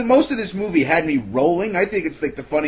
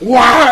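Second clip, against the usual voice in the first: mock startled yelp; 7 semitones higher, 10 dB louder than usual. Mocking flustered outburst.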